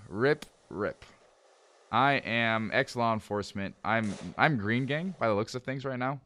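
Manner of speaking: normal talking voice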